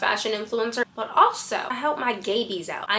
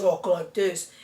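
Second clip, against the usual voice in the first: mock changed voice, talking lower; put-on deeper voice; 4 semitones lower, slower than usual. Shown as a demonstration. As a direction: exaggerate a change to your whole voice. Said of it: "change your entire voice".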